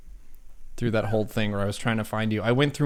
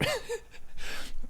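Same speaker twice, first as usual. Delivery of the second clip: quick airy high laugh; high breathy laugh; 5 dB quieter than usual. Real, not acted. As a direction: burst into a short laugh. Laughing at his own joke.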